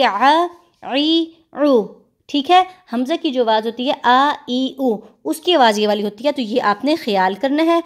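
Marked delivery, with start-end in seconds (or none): 0.0-1.8 आ ई उ pushed from the throat; ain from the throat. Shown as a demonstration. Teacher demonstrates the ain sound for students. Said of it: "कैसे आएगी".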